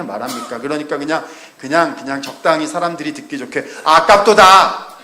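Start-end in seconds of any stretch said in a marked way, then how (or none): 3.8-5.0 loud, high, slow mock lament "아깝도다"; Judas's pious cover line. Judas feigning pious regret to please the listeners, hammed-up loud and slow. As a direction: stretch it out like a hypocrite sighing for show. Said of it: "이렇게 얘기하고 말았다는 거죠".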